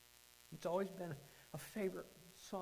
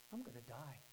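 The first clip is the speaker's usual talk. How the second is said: dawning realization; 5 semitones lower, 8 dB quieter than usual. Retold or imitated. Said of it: "It finally dawned on me".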